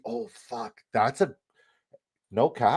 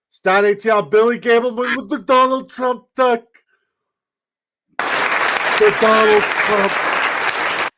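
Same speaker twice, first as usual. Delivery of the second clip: high, loud, stretched-out announcer yell; excited winner shout; 13 semitones higher, 8 dB louder, slower than usual. Real, not acted. Streamer announcing the duck race winner, excited shouting. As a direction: bellow the winner's name in disbelief.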